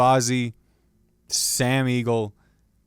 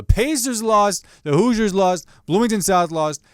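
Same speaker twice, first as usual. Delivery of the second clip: louder and higher, rattling off each loss; exasperated outburst; 9 semitones higher, 6 dB louder than usual. Real, not acted. Fan tells viewers all his teams lost, exasperated and loud. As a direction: throw up your hands at every defeat.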